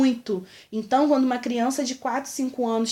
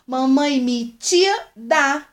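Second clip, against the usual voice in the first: put-on loud, very slow, drawn-out child call; child copying the insult; 3 semitones higher, 6 dB louder, much slower than usual. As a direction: call out like a proud little girl repeating a new word. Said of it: "Ela chegou em casa e falando".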